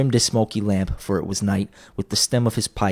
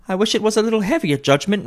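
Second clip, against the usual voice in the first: brazen woman; 13 semitones higher than usual. A narrator's reading. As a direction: chide her for staring. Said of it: "replied the woman".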